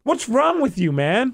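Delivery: high-pitched exasperation, acted out; retold heated challenge. Customer fed up with the rental clerk, getting heated. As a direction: demand an answer, voice jumping up. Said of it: "'cause I'm getting heated".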